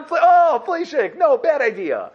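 high-pitched startled realisation; hammed-up dawning horror; mock alarm. Acted alarmed reply to screaming food.

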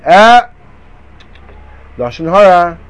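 loud, pointed warning to the aunts; pious interruption, loud and sharp; piping up. Acted. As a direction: call out the sin.

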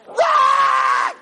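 loud acted child's shriek; sudden scream of horror; terrified scream. Boy watching the needle, screaming in terror.

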